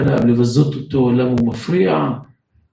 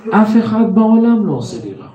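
chuckling, then higher and lighter; laughing; 8 semitones higher than usual. Retold or imitated. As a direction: laugh it off gently. Man explains calmly, laughing. (בצחוק קל)

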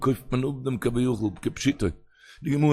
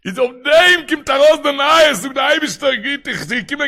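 high, fervent sing-song, easing toward the end; full-force preaching; 10 semitones higher, 5 dB louder than usual. Acted. Rabbi exhorting listeners about news from Heaven, full-force preaching. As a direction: proclaim it high and insistently.

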